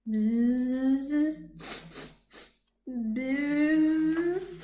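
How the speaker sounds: drawn-out imitated bee buzz. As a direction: hum it like a bee. Buzzing noise.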